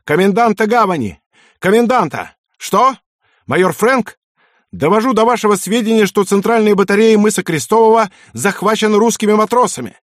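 high, loud, panicked shouting; hysterical shouting. A narrator's reading. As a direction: scream it down the phone. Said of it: "истерично кричал в трубку".

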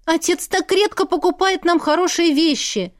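high-pitched aggrieved protest; indignant. A narrator's reading. Sister upset over the spoiled blouse, indignant. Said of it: "возмутилась она".

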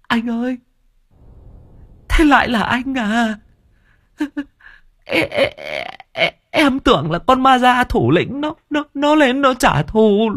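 very high, halting stammer; dazed stammer. Acted. Servant realizing he hit his brother, high stammering. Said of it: "choáng váng lắp bắp".

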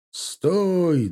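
high, drawn-out halt order; commander's shout. A narrator's reading. Battalion commander halting his men, calling out. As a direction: call a halt from a distance. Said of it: "донёсся крик командира батальона".

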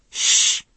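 sharp unvoiced shush; hissed shush. A narrator's reading. Strauss hushing Charlie at the party, sharp hiss. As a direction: hush him fast before she hears. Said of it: "he hissed".

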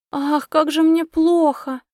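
high, slow, hammed-up lament; theatrical moaning. A narrator's reading. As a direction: overplay the suffering. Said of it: "пожаловалась Адора".

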